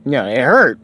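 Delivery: usual speaking voice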